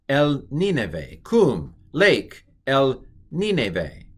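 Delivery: El Nineve read slow and syllable by syllable. Shown as a demonstration. Teacher reading the Hebrew phrase slowly to students. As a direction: spell El Nineve out slowly for learners. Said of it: "reading the Hebrew phrase slowly".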